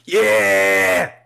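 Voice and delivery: loud cheering yeah; excited shout. Real, not acted. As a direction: whoop it out. Cheering at the screen.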